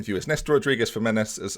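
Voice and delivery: usual speaking voice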